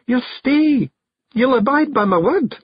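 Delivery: very high Scots wife seeking assurance; sniffly, tearful. A narrator's reading. Jeanie makes Rob confirm he'll stay, sniffly. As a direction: press him in a high, tearful voice.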